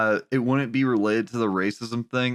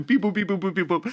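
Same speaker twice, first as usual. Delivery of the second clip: higher beep boop robot noises; toy robot voice; 6 semitones higher, faster than usual. Retold or imitated. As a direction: chirp out the beeps like a little robot. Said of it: "was literally sitting there going".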